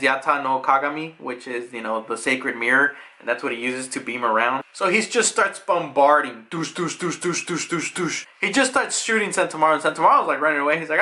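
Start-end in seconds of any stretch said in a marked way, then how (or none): 6.5-8.3 soft, rhythmic laser-shot noises; repeated mouthed explosion sounds; imitated blasts. Imitates a bombardment of shots.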